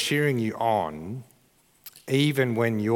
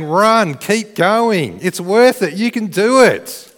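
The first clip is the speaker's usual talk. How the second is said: raised, high cheering shout; 8 semitones higher, 7 dB louder, slower than usual. Acted. Stadium crowd urging a marathon runner on, loud cheering. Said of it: "they're all cheering you on".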